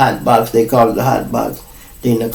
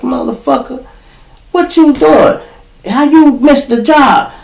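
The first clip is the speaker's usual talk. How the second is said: angry outburst; 13 semitones higher than usual. Retold or imitated. Scolding his friend for missing the job, high angry yell. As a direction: let him have it, voice jumping way up.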